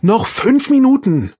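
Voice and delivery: mother calling loudly up the stairs. A narrator's reading. Mutter ruft ihren Sohn Arthur von unten, laut rufend. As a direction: call up from downstairs, high and loud. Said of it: "sondern rief gleich".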